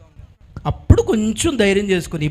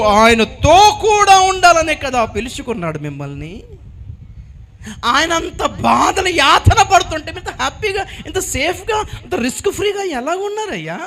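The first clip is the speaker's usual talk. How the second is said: higher, louder, slow scolding; reproachful rebuke; 6 semitones higher, 3 dB louder than usual. Acted. Mother scolds John for hiding while Jesus suffers. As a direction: shame him for hiding.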